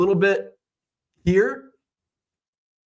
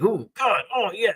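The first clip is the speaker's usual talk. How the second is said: higher-pitched, acted-out wince; mock pain; 8 semitones higher than usual. Patient feeling the injection in the chair, mock pained. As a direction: react to a needle, lightly hammed up.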